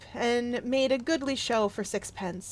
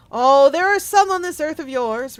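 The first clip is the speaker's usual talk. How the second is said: louder, grave ghostly reproach; solemn spirit; 4 semitones higher, 10 dB louder than usual. Acted. The Spirit of Christmas Present answers Scrooge, loud and grave. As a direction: speak as the mighty Spirit rebuking.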